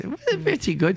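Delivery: put-on high phone voice; high-voiced airline clerk. Clerk answers the caller's small talk, high mock voice. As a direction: imitate a modest clerk in high pitch. Derek acted, high pitched.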